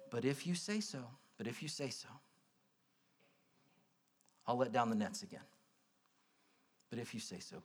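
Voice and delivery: soft, resigned sigh of a tired fisherman; reluctant and hushed. Retold or imitated. Simon murmuring to Jesus, hushed and resigned. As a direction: give in quietly, a little unconvinced.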